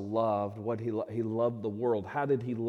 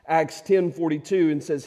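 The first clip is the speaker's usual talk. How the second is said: normal talking voice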